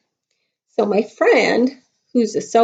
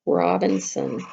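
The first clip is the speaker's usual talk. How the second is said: ordinary talk, nothing marked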